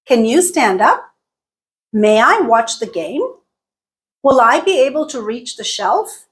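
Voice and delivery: yes-no questions climbing on UP, GAME and SHELF; rising intonation. Shown as a demonstration. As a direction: lift the pitch on the last word, UP, GAME, SHELF. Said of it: "they all have that rising intonation".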